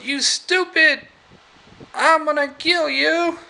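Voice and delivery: high-pitched angry doll voice; Chucky. Retold or imitated. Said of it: "and he goes".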